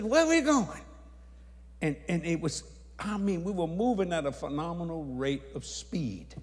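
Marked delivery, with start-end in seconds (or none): 0.0-0.8 high, loud query; loud bewildered question. Retold or imitated. Asking the angel where they're headed, loud high question. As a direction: call the question out, pitched high.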